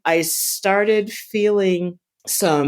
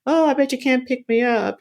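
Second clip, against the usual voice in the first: lightly joking, pitched up high; retold wisecrack, higher-pitched; 9 semitones higher than usual. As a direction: toss out a mock challenge in a higher voice. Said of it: "I'm making jokes like".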